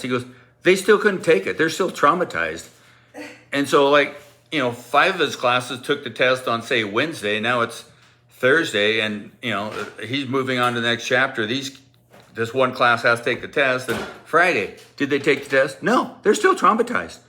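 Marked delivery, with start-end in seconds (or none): 0.6-2.7 raised, loud put-upon teacher; mock exasperation. Angry teacher answering about the test, mock exasperation. 15.8-17.2 high-pitched emphatic "No"; mock exasperation. Teacher answering about Friday's test, high mock exasperation.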